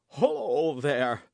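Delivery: slow, loud startled call; a startled servant's loud hail; crying out. A narrator's reading. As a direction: call out to stop an intruder. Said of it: "cried he".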